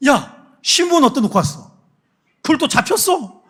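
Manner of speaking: high, loud furious yelling; furious father. Retold or imitated. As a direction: scold the sons as an outraged father. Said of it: "막 그러는 거예요".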